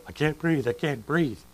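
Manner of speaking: high-pitched imitation of panicking; mock panic. Mimics a claustrophobic person panicking.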